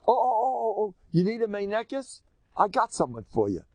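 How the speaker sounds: high excited matchmaker voice, crying out oh; an eager woman offering a nurse; Miriam's eager pitch. Retold or imitated. Miriam eagerly offering a wet nurse to the princess, high and excited. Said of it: "Miriam says".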